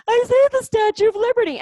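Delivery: very high, loud mock excitement; mock a clueless passenger squealing at the view; dumb idiot sightseer. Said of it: "just dumb idiots looking out the window going".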